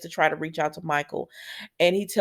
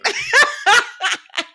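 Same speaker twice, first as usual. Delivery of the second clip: high laugh; 3 dB louder than usual. Real, not acted. Laughing at Nina on screen.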